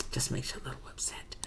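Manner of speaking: whispering the line a second time, barely audible. Real, not acted. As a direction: hush it down to a whisper as if to herself. Repeats the line, then whispers it.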